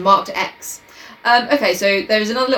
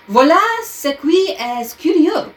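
slow, higher-pitched French flourish; 8 semitones higher, slower than usual. A narrator's reading. Showing off a little French for listeners, theatrical French. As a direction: declaim it in French as the detective. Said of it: "my little bit of French".

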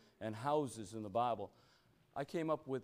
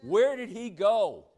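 preaching at full force; 9 semitones higher, 12 dB louder than usual. Real, not acted. Preacher driving the verse home to the church, at full force. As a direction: proclaim it to the whole room.

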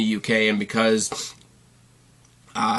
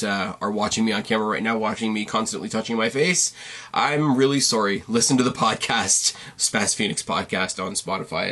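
ordinary delivery

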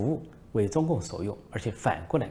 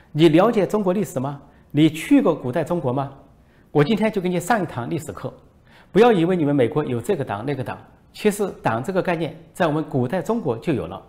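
hammed-up angry lecture, higher and louder; mock scolding; 7 semitones higher, 7 dB louder than usual. Minister loudly scolds the foreign reporter, mock fury. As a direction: play an official blowing up at a reporter. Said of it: "大声训斥外国记者".

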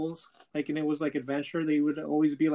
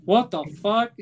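louder, higher retold outburst; mock outraged disbelief; 4 semitones higher, 8 dB louder than usual. Deuce reacting to learning who Ace's dad is, mock outrage. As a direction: act out a stunned, loud reaction.

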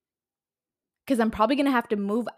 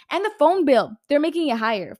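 louder and higher burst of outrage; exasperated outburst; 4 semitones higher, 7 dB louder than usual. Real, not acted. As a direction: spit it out, fed up. Listing rising bills.

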